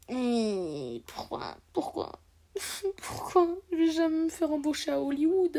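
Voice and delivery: whiny fake sobbing lament; mock crying. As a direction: wail it as a joke, pretending to cry. Mock lament over never being hired.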